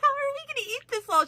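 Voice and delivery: very high, fast panicky husband; high, quick husband voice fretting over the food; flustered husband. Retold or imitated. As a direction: rush it out like a baffled husband. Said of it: "He's like".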